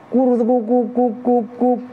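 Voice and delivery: high cooing turtledove call; dove cooing. Retold or imitated. Preacher imitating the first dove's coo. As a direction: coo it like a perkutut. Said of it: "Tapi kenapa?".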